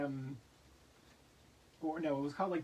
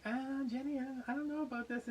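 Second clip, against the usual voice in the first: worried quote; 8 semitones higher than usual. Retold or imitated. Retold worry to friend.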